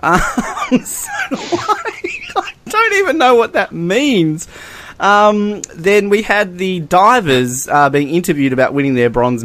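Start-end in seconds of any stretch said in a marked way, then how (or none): 0.0-4.5 high, slow, giggling; laughing disbelief. Real, not acted. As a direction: barely get it out for laughing. Laughing at the quote.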